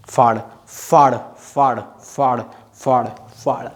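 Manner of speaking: फाड़ chanted slowly with a long aa; drill repetition. Shown as a demonstration. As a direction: drill फाड़ over and over for the class to repeat. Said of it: "बोलो".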